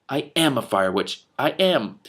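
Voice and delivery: insisting, slowing on I am; a touch of stubborn scowl. Acted. Scowling girl insisting to a doubter, slow. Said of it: "she scowled at me".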